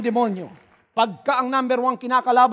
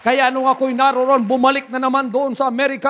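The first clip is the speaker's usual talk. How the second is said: ordinary talk, nothing marked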